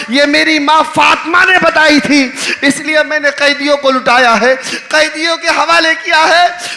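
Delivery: loud, high impassioned sermon voice; loud majlis preaching of Zainab's words; preaching at full force. Acted. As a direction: call out Zainab's words to the crowd.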